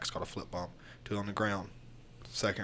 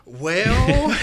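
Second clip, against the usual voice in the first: laughing; 12 semitones higher, 12 dB louder than usual. Real, not acted. Bursts out laughing at question.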